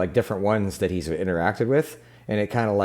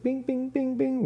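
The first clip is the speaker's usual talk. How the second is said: very high, ringing little bings; imitated chime. Shown as a demonstration. Game master doling out the yen, imitated chime. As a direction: mimic coins pinging into accounts. Imitates money landing in accounts.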